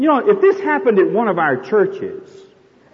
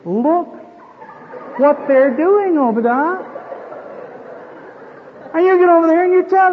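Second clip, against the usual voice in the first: anxious wife; 10 semitones higher than usual. Acted. Wife complains to husband.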